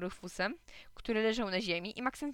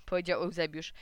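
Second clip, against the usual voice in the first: plain reading voice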